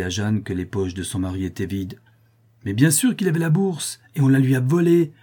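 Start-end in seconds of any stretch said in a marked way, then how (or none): 2.6-5.1 loud, high indignant protest; protesting cry. A narrator's reading. Jeanne protesting to the men, loud and high. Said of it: "se récria Jeanne".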